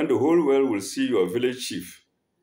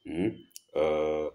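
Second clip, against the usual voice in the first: ordinary talk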